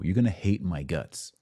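normal talking voice

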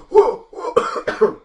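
high, loud whooping; orc crowd awe. Acted. Orcs in the stands whoop in awe. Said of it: "you see all the orcs go".

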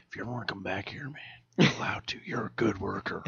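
soft, hushed whisper of a kindly boss. Retold or imitated. Store manager quietly telling Steve on his last day, whispered. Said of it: "he's all like".

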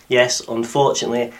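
usual speaking voice

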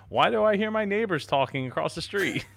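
high, whiny complaint; whiny frustrated user. Retold or imitated. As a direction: whine like a confused beginner. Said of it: "and they're just like".

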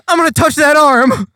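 hammed-up eager scientist; manic Hanji. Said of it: "she's like".

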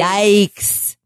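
"Yikes" read out dryly; deadpan "Yikes"; mock reading. Shown as a demonstration. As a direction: say "Yikes" with mild disdain. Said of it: "the word yikes is written here".

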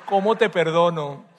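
Esau begging his father, mock whine; whiny teen plea. Esau voiced pleading to father.

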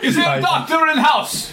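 put-on high, laughing Russian accent shout. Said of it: "Good Russian accent".

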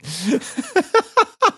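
rising, breathless laugh up high; high squealing laugh. Real, not acted. Laughing at the choir quote.

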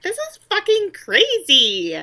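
high, loud, slow exclamation; exaggerated cry of disbelief; mock excited outburst. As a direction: stretch it out and push the pitch way up. Escalated take of her reaction.

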